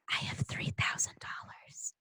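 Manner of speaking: breathy unvoiced whisper under her breath. Little girl mutters to herself, whispering. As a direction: whisper it just to yourself. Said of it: "under her breath".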